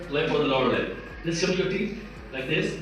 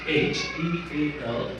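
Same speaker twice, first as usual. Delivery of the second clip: slow spelling; 4 semitones lower, slower than usual. Shown as a demonstration. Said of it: "what works with T H".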